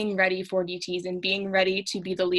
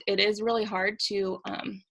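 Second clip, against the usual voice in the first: ordinary delivery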